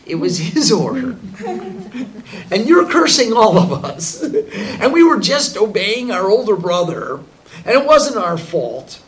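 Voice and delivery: loud, high pleading through laughter; laughing plea. Acted. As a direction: beg for mercy while giggling. Brothers begging the sage.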